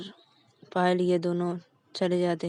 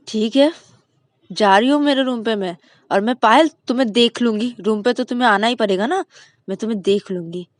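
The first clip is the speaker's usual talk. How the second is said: sulky threat; 3 semitones higher, 9 dB louder than usual. Acted. Ananya threatens Payal.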